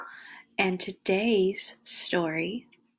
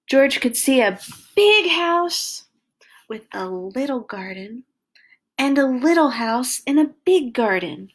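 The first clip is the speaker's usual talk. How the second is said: put-on lively read-aloud for little kids; reading to children; 5 semitones higher, 7 dB louder than usual. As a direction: tell it like a bedtime storybook. Reads picture book to children.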